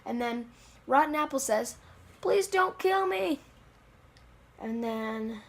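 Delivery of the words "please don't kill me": high, slow begging; mock pleading. Rotten Apple begs the giant dad, high mock plea. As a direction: plead in a small put-on voice.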